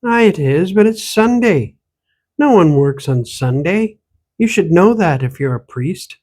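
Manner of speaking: put-on old woman scolds the stranger in an Irish-Ontario accent. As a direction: tease him in the Irish-Valley accent. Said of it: "The accent she spoke with".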